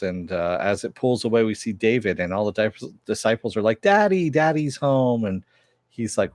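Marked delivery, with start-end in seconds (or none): 3.8-5.2 high squealing mock cheer of fans; excited greeting. Disciples greeting David at home, high mock squeal. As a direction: squeal it like adoring groupies.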